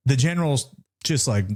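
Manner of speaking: ordinary talk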